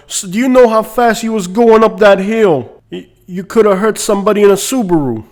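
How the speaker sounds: loud, high mock New York policeman; New York cop. New York cop scolding a driver, mock loud officer. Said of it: "pulled over by a cop who moved here from New York".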